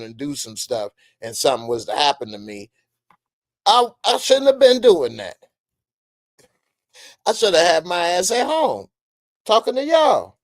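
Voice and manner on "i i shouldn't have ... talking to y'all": higher, louder emphatic insistence; raised-voice lecture to the audience. Real, not acted. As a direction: drive the point home hard. Emphatic self-scolding.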